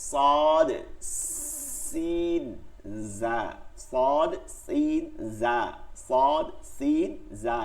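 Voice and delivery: "সদ, সিন, জা" with strong hissing and buzzing; whistled letter drill. Shown as a demonstration. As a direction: draw out the sibilant in each of "সদ, সিন, জা". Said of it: "এর পরের তিনটা হচ্ছে শব্দ করতে হবে". ("সদ, সিন, জা" শিস দিয়ে টেনে উচ্চারণ)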